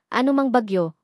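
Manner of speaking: plain reading voice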